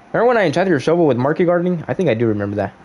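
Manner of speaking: normal talking voice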